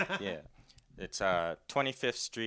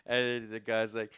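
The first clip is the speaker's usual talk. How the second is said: ordinary talk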